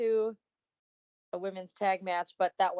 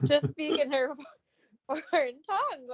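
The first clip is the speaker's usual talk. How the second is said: laughing through the words; breaking into giggles, pitched up; 9 semitones higher, 4 dB louder than usual. Real, not acted. Co-host cracking up at own clumsy wording, laughing.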